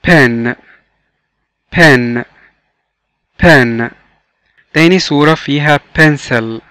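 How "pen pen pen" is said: PEN said alone, clearly, with falling pitch; model pronunciation. Shown as a demonstration. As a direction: model "pen" for learners to repeat. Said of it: "Repeat after me". (نطق تعليمي واضح لكلمة pen)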